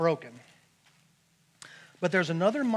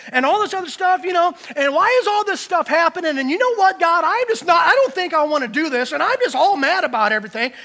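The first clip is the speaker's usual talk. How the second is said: loud, heated complaining to God; mock self-righteous outburst at God; acted angry rant; 10 semitones higher, 11 dB louder than usual. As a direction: vent like a fed-up, resentful believer. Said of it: "how quickly we go from praising the Lord to anger".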